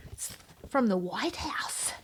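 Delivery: slow awed whisper. Acted. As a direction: hush it like something sacred. Awed whisper about letter.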